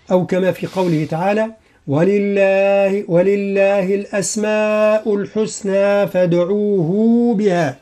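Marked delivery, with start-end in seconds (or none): 1.9-7.8 slow Quranic chant; Quran recitation. Shown as a demonstration. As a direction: recite the verse with tajweed. Recites the verse. (تلاوة مجودة بطيئة)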